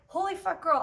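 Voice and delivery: high, loud burst of amazed joy; stunned delight. Retold or imitated. Reacting to Biddy moving into her heart, high amazed outburst. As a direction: blurt it out, thrilled and disbelieving.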